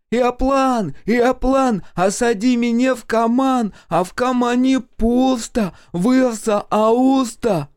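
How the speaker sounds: high, drawn-out chanting holler; gleeful yelling. A narrator's reading. Half-witted Mitka dancing across the field under a plane, gleeful high yelling. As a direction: yell it like a giddy simpleton's chant. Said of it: "пританцовывая и вопя".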